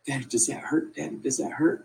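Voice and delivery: high, breathy little-girl voice, lightly voiced; little daughter worried. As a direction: ask softly like a worried small child. Daughter voiced, watching wound unwrapped.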